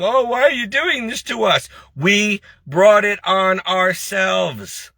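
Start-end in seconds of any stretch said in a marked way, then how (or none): 0.0-1.7 higher-pitched mocking plea to God; mock crying out. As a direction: imitate a complainer crying out to God. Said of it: "don't you dare cry out to God".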